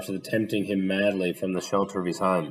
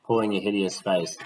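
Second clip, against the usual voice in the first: ordinary delivery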